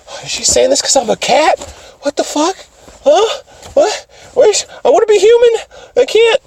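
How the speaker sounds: high, hammed-up panicky distress; acted high-pitched meltdown; imitating a freak-out. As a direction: fret in a high mock-upset voice. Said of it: "freaking out about it".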